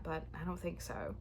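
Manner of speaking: ordinary delivery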